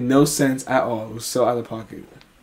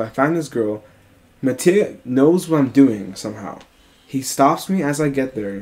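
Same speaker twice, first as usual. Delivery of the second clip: ordinary talk, nothing marked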